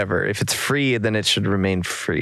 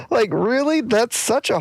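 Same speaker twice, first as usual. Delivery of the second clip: incredulous; 12 semitones higher than usual. Real, not acted. Incredulous at harsh charge.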